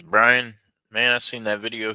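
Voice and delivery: loud call to a friend. Real, not acted. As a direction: shout his name out. Calling out to viewer.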